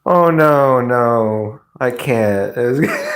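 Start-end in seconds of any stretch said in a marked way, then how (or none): none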